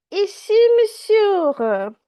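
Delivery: put-on high, loud and slow, a small boy calling up to a man; little boy calling up. Little Donald calling up to the stranger, high and loud. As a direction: call up from below like a little kid.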